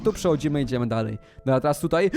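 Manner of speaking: ordinary delivery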